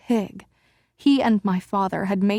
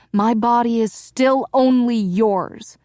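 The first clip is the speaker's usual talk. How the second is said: bitter, a touch louder; wife spitting out a bitter vow to her husband; spat words; 4 semitones higher, 6 dB louder than usual. Acted. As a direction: spit the words out at him. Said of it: "I practically spat the last few words".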